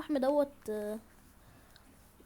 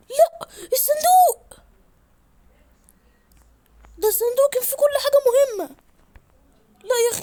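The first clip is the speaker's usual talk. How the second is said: anguished wail; 12 semitones higher, 11 dB louder than usual. Real, not acted. Gamer losing his chest full of important things, wailing. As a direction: howl over the lost box.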